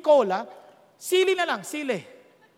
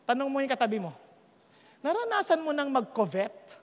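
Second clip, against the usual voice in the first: normal talking voice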